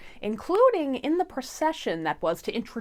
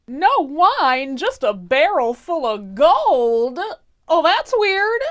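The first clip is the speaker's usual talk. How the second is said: higher and louder, bewildered at the gold; baffled king; 8 semitones higher, 8 dB louder, slower than usual. Acted. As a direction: squeak out the surprise, rising to a yelp on weird. King finds gold instead of wine.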